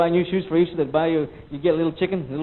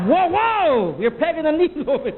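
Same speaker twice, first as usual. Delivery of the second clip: high startled whoa, pitched way up; alarmed yell; 10 semitones higher, 4 dB louder, slower than usual. Retold or imitated. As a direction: yelp as the cuff squeezes too hard. Nurse taking blood pressure.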